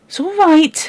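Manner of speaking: put-on high, soothing child's reassurance; calm the crying baby; lightly voiced girl soothing a baby; comforting girl. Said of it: "she said".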